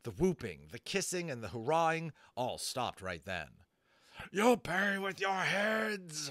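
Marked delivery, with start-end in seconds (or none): 4.3-6.3 high, slow, shrieking bird voice; shrieking rage. A narrator's reading. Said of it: "Flag shrieked".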